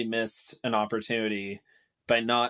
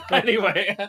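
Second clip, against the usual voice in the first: laughing; 9 semitones higher, 6 dB louder than usual. Real, not acted.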